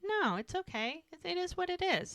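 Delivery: high, light imitation of shrugging it off; dismissive reassurance. Woman to her apologetic boyfriend, lightly reassuring. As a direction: brush away the sympathy, pitched high. She brushes off his sympathy.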